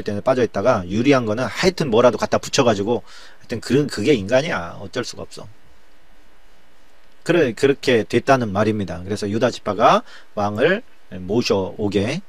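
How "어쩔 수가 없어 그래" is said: ordinary talk, nothing marked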